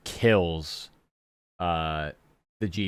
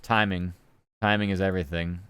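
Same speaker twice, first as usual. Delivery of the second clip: usual speaking voice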